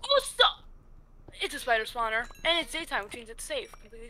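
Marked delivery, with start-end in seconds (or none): 0.0-0.6 high startled cry. Real, not acted. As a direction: jump at the sudden spider. Spider spawner appears.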